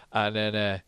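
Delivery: ordinary delivery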